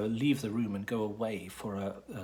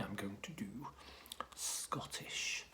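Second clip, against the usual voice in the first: whisper; 2 semitones lower, 10 dB quieter than usual. Pretend. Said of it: "whispered reveal".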